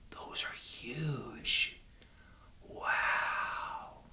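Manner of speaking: slow, whispered wonder; hushed awe. Pretend. Walker marvelling at imagined redwoods, hushed awe. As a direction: whisper in amazement, drawing the words out.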